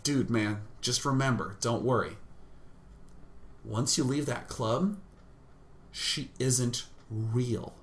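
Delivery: low, softened voice consoling a friend; calm reassurance. Retold or imitated. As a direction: reassure him gently, acted out low. Retelling how he consoled upset friend.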